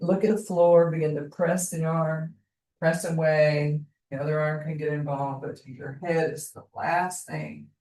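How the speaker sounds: calm, even instructor voice guiding the class; yoga class cue. Acted. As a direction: talk them gently through each movement. Yoga teacher guiding movement.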